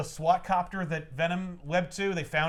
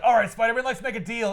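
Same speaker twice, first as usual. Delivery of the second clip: mock menacing; 6 semitones higher, 6 dB louder than usual. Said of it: "in his most menacing pose yet".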